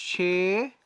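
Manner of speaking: শেখানো stretched out in separate syllables; syllable-by-syllable pronunciation. Shown as a demonstration. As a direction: sound out শেখানো slowly, she... kha... no. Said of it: "দেখো, এটা হচ্ছে শেখানো".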